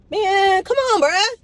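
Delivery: high, loud exasperated cry at a driver; frustrated yell. Real, not acted. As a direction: yell at the slow car in front.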